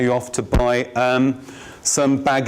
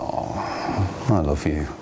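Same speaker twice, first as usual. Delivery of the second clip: soft and low, lovingly; a tender low declaration of love; low, tender I love you; 8 semitones lower, 4 dB quieter than usual. Shown as a demonstration. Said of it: "Their tone's low".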